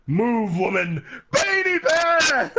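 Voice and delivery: mock drunk shout, rising to a high slow yell; hammed-up drunk shouting at his bride; drunken yell. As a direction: bellow it like a drunk groom. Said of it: "He literally went".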